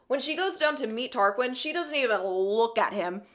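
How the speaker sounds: usual speaking voice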